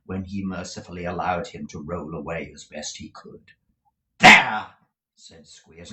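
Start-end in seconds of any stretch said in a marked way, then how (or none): 4.2-4.7 very loud, final bark; triumphant bark. Acted. Squeers finishing the beating with a loud triumphant bark. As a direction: slam the word down.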